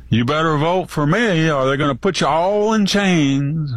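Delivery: pandering politician. Retold or imitated. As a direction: put on a pandering accent for the crowd. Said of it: "Can you just see Trump going".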